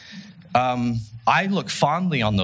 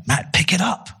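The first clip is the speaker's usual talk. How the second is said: retold scream; 7 semitones higher than usual. Coach to winded player at halftime, retold scream. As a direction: imitate a yelling coach with raised pitch, volume held back. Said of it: "he screamed".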